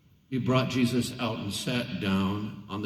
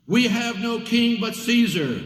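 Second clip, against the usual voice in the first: loud, high declaration; defiant; 11 semitones higher, 5 dB louder than usual. A narrator's reading. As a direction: fling it back at Pilate. Chief priests answer loudly.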